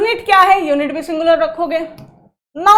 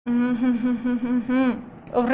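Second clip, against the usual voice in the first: thinking hum; 5 semitones lower, 8 dB quieter than usual. Real, not acted. Teacher thinking aloud.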